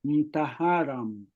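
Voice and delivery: mtaharam said slowly, every syllable clear; pronouncing mtaharam. Shown as a demonstration. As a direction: spell out mtaharam slowly for the class. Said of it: "we can say mtaharam".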